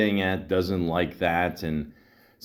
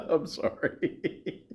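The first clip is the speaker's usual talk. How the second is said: laughing; 7 semitones higher, 4 dB quieter, much slower than usual. Real, not acted. Laughing after the played prank call.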